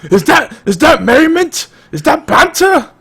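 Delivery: a hammed-up Scottish accent; bad Scottish accent. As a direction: attempt a Scottish accent and fail. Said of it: "I can't do Scottish accent".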